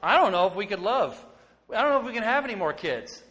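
high, loud, overjoyed gushing; gushing new father. Retold or imitated. Father telling wife about firstborn, gushing excitedly. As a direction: burst with love for the baby. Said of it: "I'd say it to my wife".

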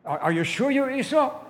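high, loud, suspicious question; insistent questioning. Acted. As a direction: press the doubt loudly and insistently. Father repeats question, more insistently.

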